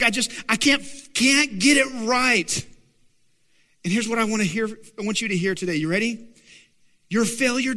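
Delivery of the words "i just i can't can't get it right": higher, louder, dragged-out self-reproach; mock exasperation. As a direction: drag out the admission of defeat in frustration. Acts out defeated self-talk.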